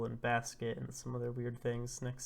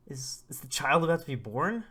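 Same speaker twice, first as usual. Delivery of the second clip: acted flustered alarm, louder; mock panic; 2 semitones higher, 8 dB louder than usual. Expectant father reacting to his partner's labour, acted panic. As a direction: stammer into an anxious question, voice lifting at the end.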